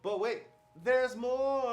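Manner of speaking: loud, high, drawn-out infomercial hook; shopping-channel host. Acted. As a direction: announce it like a TV shopping bonus. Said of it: "cable network shopping".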